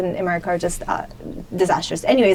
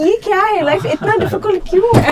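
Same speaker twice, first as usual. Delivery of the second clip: whiny lament; 8 semitones higher, much faster than usual. Retold or imitated. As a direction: moan to the world about hard life. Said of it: "I'm always crying".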